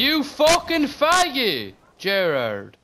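slow, drawn-out furious yell; angry yell. Real, not acted. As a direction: bellow it at him slowly. Yelling at teammate mid-fight.

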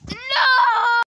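loud, high cry of refusal; desperate shout. Pretend. Mario told to say his prayers, desperate shout. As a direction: shout it out in play-acted horror.

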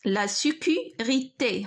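slow, broken into syllables: لا سيكوريتي; slow dictation. Shown as a demonstration. Teacher writing the word out for students, slow syllable-by-syllable dictation.

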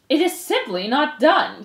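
slow, loud, high indignation; disapproving aunt. Acted. Aunt Florence objects from the crowd, disapproving. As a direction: pronounce it as a scandalized verdict. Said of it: "came Aunt Florence's voice".

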